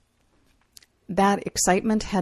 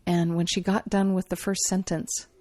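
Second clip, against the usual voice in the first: ordinary delivery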